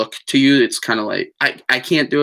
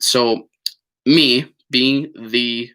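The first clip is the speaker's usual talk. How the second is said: ordinary delivery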